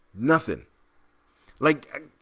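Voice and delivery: ordinary talk, nothing marked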